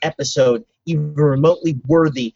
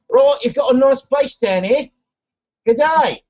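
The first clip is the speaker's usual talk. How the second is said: lightly clipped posh British mimicry; doing Constantine as a toff; mock British Constantine; 7 semitones higher than usual. Friend mimics Constantine brushing someone off, British-ish. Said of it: "Good day. I think he's British".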